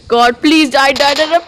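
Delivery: high, loud frantic begging; panicked pleading. Real, not acted. Gamer begging the enemy to die, panicked pleading.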